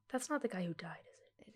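whispered, worried question; hushed aside. Real, not acted. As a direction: drop to a whisper to check discreetly. Discreet worried aside about a death.

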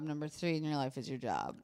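deadpan fading joke ending; fading deadpan punchline. Shown as a demonstration. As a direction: let it fade into the punchline. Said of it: "I joke that".

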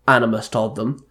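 usual speaking voice

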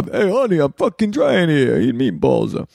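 put-on jokey Brooklyn Italian accent, exasperated; hammed-up Brooklyn Italian wiseguy pleading with honey; playful Brooklyn Italian accent bit; Brooklyn Italian guy. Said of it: "I turn into an Italian guy from Bro-, from the Brooklyn".

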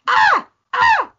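shrill high shriek, twice; two loud high-pitched yelps; scream on each hit. Shown as a demonstration. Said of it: "But I would scream".

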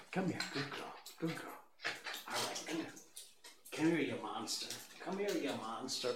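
very quiet, fond talk to a dog; soft coaxing. Real, not acted. Host praises and coaxes the dog, hushed pet talk.

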